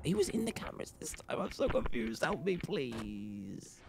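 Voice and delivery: hushed, frightened murmur; breathy scared plea; scared whisper. Real, not acted. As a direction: whisper it as if something might hear. Scared whisper during horror game.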